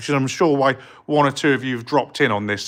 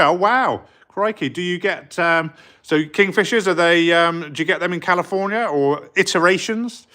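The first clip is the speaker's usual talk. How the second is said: ordinary talk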